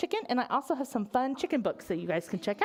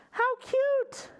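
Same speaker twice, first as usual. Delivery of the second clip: cooing delight; 12 semitones higher than usual. Real, not acted. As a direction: coo it up high. Cooing over the chicks.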